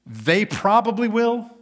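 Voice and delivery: a cocky fisherman's boast; boastful Peter. Acted. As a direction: puff up like a bragging disciple.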